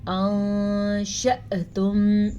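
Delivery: أَأَنْتُمْ with a hidden nasal noon; nasal ghunna; ikhfa nasal. Shown as a demonstration. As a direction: hum the ن in أَأَنْتُمْ through the nose. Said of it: "اخفا کا قاعدہ ہو رہا ہے".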